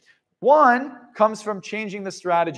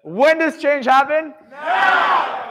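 rallying shout; 6 semitones higher, much slower than usual. Real, not acted. Firing up the audience with a shouted call. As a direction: shout it out to the crowd.

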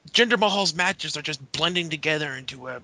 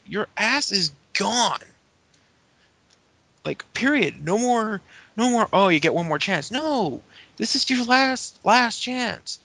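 high-pitched, emphatic mock dressing-down; put-on high voice, slow stern warning; imitated boss's ultimatum; 8 semitones higher, 3 dB louder, slower than usual. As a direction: lay down the law as a fed-up boss. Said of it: "Teddy basically go-, tells Drew".

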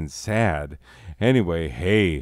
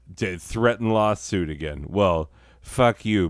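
normal talking voice

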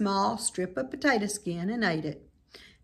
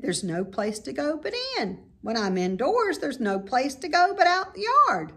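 put-on whiny young pig; 7 semitones higher than usual.

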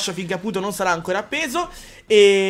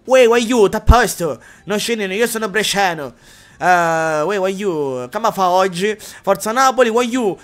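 loud hammed-up southern Italian dialect voice; loud mock Neapolitan accent; 5 dB louder, slower than usual. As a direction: shout it like a cartoon Neapolitan. Reading chat dialects in mock voices.

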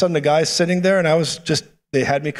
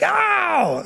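high-pitched imitated yelp; drawn-out high mock cry of shock. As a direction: yelp as if struck, acted. Man's reaction to prophecy, acted out.

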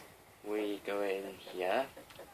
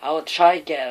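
loud burst; 7 semitones higher, 14 dB louder than usual. Real, not acted. Loud burst while playing.

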